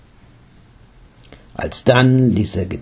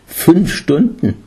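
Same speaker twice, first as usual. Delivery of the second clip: slow, higher-pitched disbelief; astonished; 7 semitones higher, slower than usual. A narrator's reading. Jesus learning how long he sat, astonished. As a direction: echo the figure in amazement, drawn out. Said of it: "Jesus war erstaunt".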